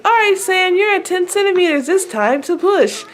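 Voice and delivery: high, singing-out doctor voice; high-pitched announcement, slowing at the end. Retold or imitated. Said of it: "the doctor was like".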